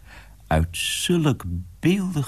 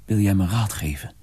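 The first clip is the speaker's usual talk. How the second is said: ordinary talk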